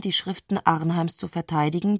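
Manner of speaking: normal talking voice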